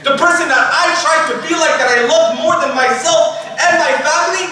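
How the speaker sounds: high, impassioned listing; fervent declaration. Acted. Soul naming the Prophet it loved, fervent declaration. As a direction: build phrase on phrase with devotion. Soul proudly answers second question.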